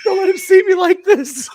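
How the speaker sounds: high, loud hammed-up plea; mock soap-opera wail; melodramatic plea. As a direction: overact a tragic heroine. Voicing the beaten wrestler dramatically.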